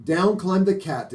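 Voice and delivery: plain reading voice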